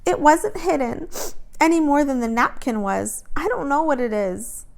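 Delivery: high, tearful denial. A narrator's reading. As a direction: plead through tears. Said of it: "wiped her eyes".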